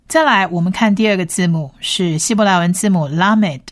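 ordinary delivery

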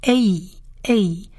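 a drawn out as "ay"; blended-sound demo. Shown as a demonstration. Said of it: "的音合起来是".

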